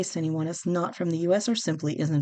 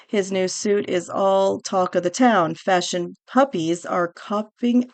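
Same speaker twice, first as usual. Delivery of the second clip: newsreel read; 3 semitones higher, 4 dB louder than usual. Acted. Host reading the prince article aloud, newsreel announcer style. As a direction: announce the story like a vintage newsreel.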